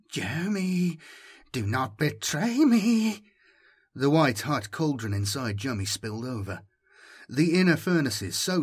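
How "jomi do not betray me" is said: high-pitched, drawn-out appeal; high plea. A narrator's reading. Voice begs Jomi not to betray it, high plea. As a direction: plead slowly in a high voice.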